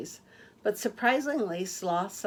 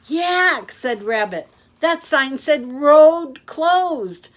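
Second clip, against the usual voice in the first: alarmed warning; 7 semitones higher, 12 dB louder, slower than usual. Acted. Rabbit warning Yak.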